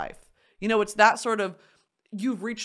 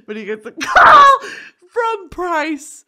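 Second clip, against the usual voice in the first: laughing; 13 semitones higher, 15 dB louder, slower than usual. Real, not acted. Laughing at sad twist.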